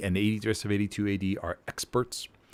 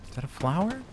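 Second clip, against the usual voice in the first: higher, incredulous second ask; puzzled rising question; 9 semitones higher than usual. Real, not acted. Caster asking his buddy about the odd symbol, puzzled and higher. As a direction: ask it again, now genuinely puzzled.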